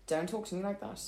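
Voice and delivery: firm put-down. Shown as a demonstration. Advising friend how to shut down rude men, firm. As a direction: set the boundary without raising the voice. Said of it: "and in this tone".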